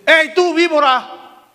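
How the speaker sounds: slow, loud, high shout across the crowd; Juan el Bautista shouting. Acted. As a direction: call them out like a nest of vipers.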